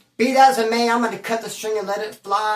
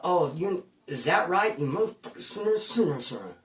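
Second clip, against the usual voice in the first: muttered curse; 3 semitones lower, 7 dB quieter than usual. Retold or imitated. Bitter thought about cellmate.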